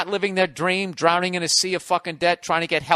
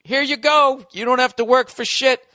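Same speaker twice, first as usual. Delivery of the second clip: mock casting director; 6 semitones higher, 3 dB louder than usual. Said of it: "And then".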